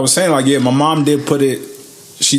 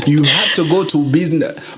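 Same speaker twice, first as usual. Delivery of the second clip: spoken Nigerian parent voice; Nigerian accent; 2 semitones higher than usual. Retold or imitated. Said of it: "my Nigeria accent might be terrible".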